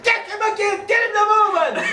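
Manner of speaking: high, loud laughing command; urging shout. Retold or imitated. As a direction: push him on, shouting. Sergeant urging rough treatment.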